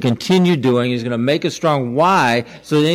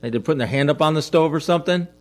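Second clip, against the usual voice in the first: normal talking voice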